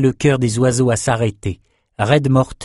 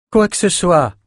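mild call after him, higher; 8 semitones higher than usual. Acted. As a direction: call to him as he walks away. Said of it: "cria-t-elle à Bannon". (appel modéré, voix plus aiguë)

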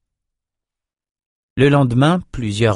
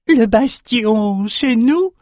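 much higher, slow, strange voice rising to a question; excited runt question; 13 semitones higher, much slower than usual. A narrator's reading. The runt shaman echoes the king, high and questioning. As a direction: squeak it out in disbelief, asking.

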